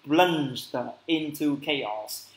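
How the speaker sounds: very slow, careful English; slow model phrase. Shown as a demonstration. Teacher models the phrase slowly for the class. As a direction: lay out "plunged into chaos" word by word. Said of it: "hoặc là be".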